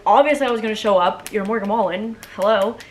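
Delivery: a loud duh, trying to sound nonchalant; telling the star in a dream it was obvious she'd come; overplayed cool. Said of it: "trying to play it cool and not".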